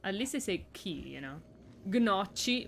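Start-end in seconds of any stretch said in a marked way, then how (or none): 1.8-2.7 gnocchi as "guh-NOTCH-ee"; hard g, then a ch sound in gnocchi; worse mispronunciation. Shown as a demonstration. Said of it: "would be worse".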